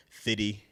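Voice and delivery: "fitty" with the second F gone; dropped middle consonant. Shown as a demonstration. Midwesterner showing local speech, "fitty" with a letter killed. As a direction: say "fitty" for fifty. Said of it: "whatever that letter was supposed to be".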